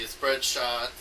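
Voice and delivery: usual speaking voice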